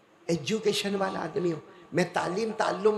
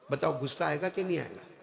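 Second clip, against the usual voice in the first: ordinary delivery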